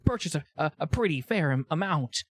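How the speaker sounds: jittery seller stumbling through an explanation; halting, anxious character voice; nervous vendor. Acted.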